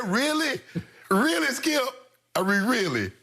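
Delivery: high, stretched disbelief; incredulous really. Real, not acted. Asking Skip about crying after a win, high disbelief.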